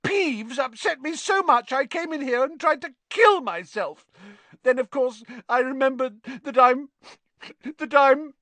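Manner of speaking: high, tearful melodrama, faltering at the end; self-pitying wailing ghost; dramatic. A narrator's reading. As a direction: pour out your woes theatrically, then stall. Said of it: "said Myrtle dramatically".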